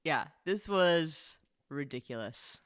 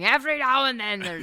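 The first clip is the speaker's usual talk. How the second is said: attempted Connery accent; 5 semitones higher, 5 dB louder than usual. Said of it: "I can't even do it".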